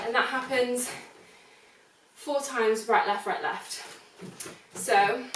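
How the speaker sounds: ordinary talk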